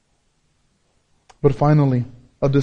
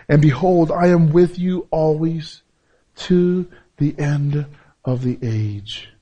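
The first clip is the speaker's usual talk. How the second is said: slow scripture reading; slower than usual. Shown as a demonstration. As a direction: read the promise slowly, drawing it out. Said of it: "quoting the verse slowly".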